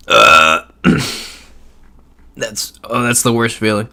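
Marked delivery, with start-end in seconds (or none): 0.1-1.3 harsh coughing fit; coughing up water. Real, not acted. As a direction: cough hard, still choking.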